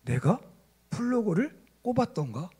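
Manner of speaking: soft, halting self-doubt; acted dawning dread. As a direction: quietly ask yourself as a chilling realisation creeps in. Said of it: "갑자기 등골에서 오싹한 기운이". (오싹해서 작게 혼잣말하는 연기)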